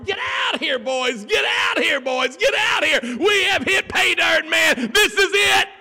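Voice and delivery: full-force, very high excited shouting; hollering. Acted. Fishermen calling their partners to a huge catch, hollering. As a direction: holler at the top of your range, calling the boys over. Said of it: "and they're hollering".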